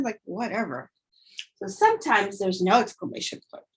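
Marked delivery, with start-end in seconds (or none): 0.2-0.9 quiet attitude. Shown as a demonstration. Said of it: "but you could hear it".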